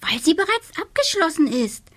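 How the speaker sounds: high, put-upon witch voice; little witch voice. A narrator's reading. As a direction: sound like a scatterbrained little witch. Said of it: "antwortete die kleine Hexe".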